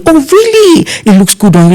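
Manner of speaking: high, gushing oh really; flattering surprise. Retold or imitated. Planner gushing over the uncle's outfit, high voice. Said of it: "Then you say".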